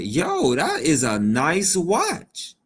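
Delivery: slow, drawn-out admiring yo; impressed exclamation. Retold or imitated. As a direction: savor the words in amazement. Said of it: "I said".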